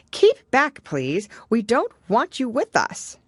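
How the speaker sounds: put-on a girl shooing off a porpoise; Alice's imagined retort. A narrator's reading. Alice quoting what she'd say.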